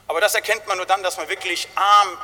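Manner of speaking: ordinary talk, nothing marked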